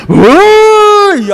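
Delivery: loud, high, drawn-out animal cry; imitated stag bellow. Preacher mimicking how a thirsty stag cries out, piercing bellow. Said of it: "cómo brama un siervo cuando tiene sed".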